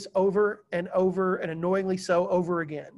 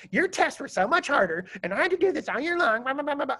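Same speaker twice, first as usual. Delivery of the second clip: lightly voiced whiny girl, higher; complaining student; 6 semitones higher than usual. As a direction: mimic the girl griping about his hard tests. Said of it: "She goes".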